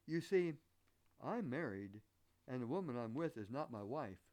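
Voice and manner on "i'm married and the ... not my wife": normal talking voice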